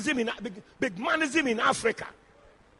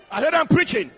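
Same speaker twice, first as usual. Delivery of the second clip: louder, insistent repeat to the room; 3 semitones higher, 5 dB louder than usual. Real, not acted. Preacher demanding the congregation's attention, insistent. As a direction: press the point home with raised voice.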